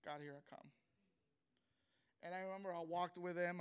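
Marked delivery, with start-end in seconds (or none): 0.0-0.7 low, hushed private prayer; quietly to oneself. Retold or imitated. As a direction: say it softly under your breath. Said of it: "quietly to myself".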